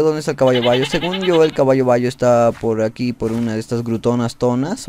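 ordinary delivery

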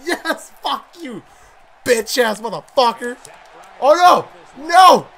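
hyped yelling. Real, not acted. Gamer reacting live to a wrestling match, excited yelling. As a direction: cheer and curse at the fight.

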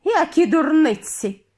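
high, slow, loud scoff; outraged head witch's exclamation; indignant. A narrator's reading. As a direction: dismiss it with indignation. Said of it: "обурилася верховна відьма".

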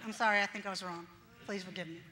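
sheepish apology. Retold or imitated. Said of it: "And I'm like".

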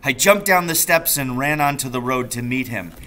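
ordinary delivery, nothing marked

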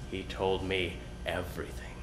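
hushed, almost unvoiced whisper. A narrator's reading. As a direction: let it fall to a secret whisper. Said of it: "began to whisper".

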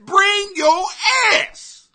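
normal talking voice

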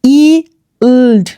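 ইল্ড drawn out in parts, "eee... ld"; long E. Shown as a demonstration. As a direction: sound out ইল্ড slowly with held "ee". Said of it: "ই এর মতো করে sound করে". (ইল্ড ভেঙে টানা ই)

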